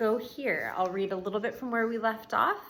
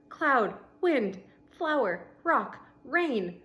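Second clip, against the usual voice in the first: steady read-aloud voice for the class; story narration; 3 dB louder, slower than usual. A narrator's reading. Teacher reading a novel to her class, storybook narration.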